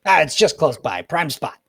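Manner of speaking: a booming badger father; badger dad voice. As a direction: call it out proudly like a cheerful dad. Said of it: "and he goes".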